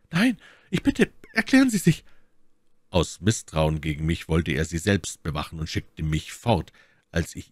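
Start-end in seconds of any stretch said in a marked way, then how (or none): 0.1-2.0 very high falsetto entreaty; My Lady pleading. A narrator's reading. Lady begging Felton to explain, high and insistent. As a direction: urge him in a high lady's voice.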